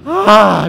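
loud, high yelp of fright; frightened scream. Real, not acted. Player scared by the TV, loud scream. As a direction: cry out from a real scare. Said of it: "Me asusté".

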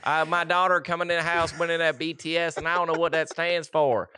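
a Southern father grumbling about his daughter's band; Southern dad accent. Pretend. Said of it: "We've done a Southern accent".

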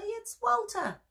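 very high, slow, delighted cry; excited cry. A narrator's reading. Billy spots Walter in the package, high excited cry. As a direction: cry it out with joy. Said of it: "cried Billy".

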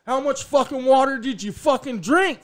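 loud, high incredulous koala; exclaimed punchline. Acted. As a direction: hit the punchline in mock amazement.

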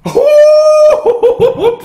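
high, loud laughing whoop at the line; excited whoop. Real, not acted. Hears a hard lyric, erupts.

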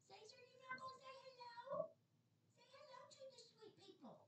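very soft, high-pitched coaxing; pet talk. Real, not acted. Owner coaxing Caesar to say hello, soft pet talk. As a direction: coo to a pet in a tiny high voice.

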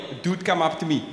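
usual speaking voice

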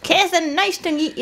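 put-on high old granny puppet voice. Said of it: "can you introduce us to one or two".

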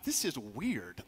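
quiet, slow puzzled mutter; hushed and slow, half to himself; quietly bemused; mock puzzled aside. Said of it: "I'm like".

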